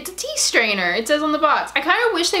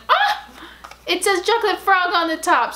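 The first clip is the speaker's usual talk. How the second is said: very high shriek of joy; excited shriek; 7 semitones higher than usual. Real, not acted. Shrieking at the chocolate frog box, high and excited. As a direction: yelp with glee.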